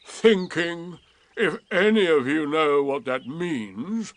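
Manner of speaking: lightly voiced gloomy donkey; slow, dry and put upon; Eeyore. A narrator's reading. Eeyore correcting his friends, lightly voiced and dry.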